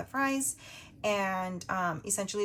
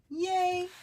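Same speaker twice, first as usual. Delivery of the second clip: excited cheer; 10 semitones higher, 4 dB louder than usual. Real, not acted. Reached the hundred dollar mark.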